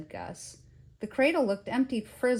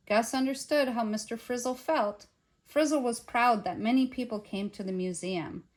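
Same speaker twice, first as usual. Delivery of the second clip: usual speaking voice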